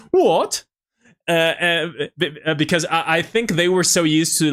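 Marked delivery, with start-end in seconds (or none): 0.0-0.6 surprised. Retold or imitated. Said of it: "they're like".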